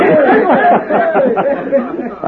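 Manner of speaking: long, high put-on laugh; hearty mock laugh. Stranger goads the cafe owner, hearty mock laugh. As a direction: laugh it up to needle the cafe man.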